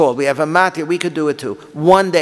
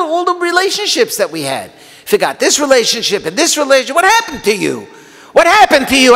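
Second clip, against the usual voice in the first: high, louder outcry of betrayal; anguished accusation; 9 semitones higher, 4 dB louder than usual. Shown as a demonstration. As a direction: hurl the questions at God, wounded and pleading. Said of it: "read it with the right tone of voice".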